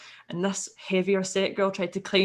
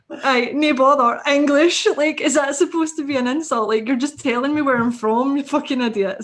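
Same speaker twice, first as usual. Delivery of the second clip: higher and louder, scornful Scottish comeback; indignant Scottish snap back; mocking angry retort; 6 semitones higher, 7 dB louder than usual. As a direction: throw the taunt back in her face. Retort thrown back at the girl.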